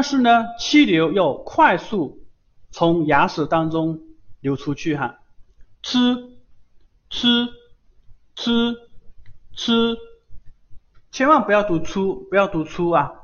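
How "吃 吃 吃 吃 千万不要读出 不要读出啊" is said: 吃 said as Japanese chi, tongue tip on the gum ridge, quick air through the teeth; Japanese chi demo. Shown as a demonstration. Teacher models 吃 for the online students, higher. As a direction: model 吃 slowly and clearly, higher, for the class. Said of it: "是舌尖抵住上齿龈".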